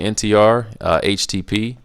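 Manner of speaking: ordinary talk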